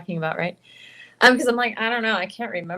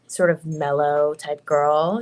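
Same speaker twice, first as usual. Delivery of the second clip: lower, slow mellow voice; low mellow girl voice; 6 semitones lower, slower than usual. Shown as a demonstration. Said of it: "we lower our voices to do".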